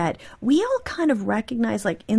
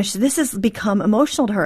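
usual speaking voice

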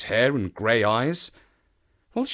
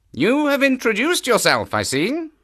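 woman's voice; 12 semitones higher, 4 dB louder than usual. A narrator's reading.

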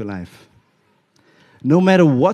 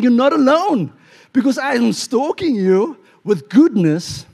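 high, forceful, emphatic declaration; the Lord's answer; 8 semitones higher, 4 dB louder than usual. Acted. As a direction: proclaim it in God's voice from the pulpit. Voices the Lord answering the lament.

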